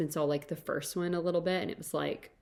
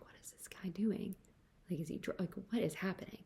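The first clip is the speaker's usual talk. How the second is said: hushed, breathless inner question; whispered thought; 3 semitones higher, 7 dB quieter than usual. Retold or imitated. Wondering to herself about the pushy guy, whispered. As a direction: whisper it to yourself, baffled.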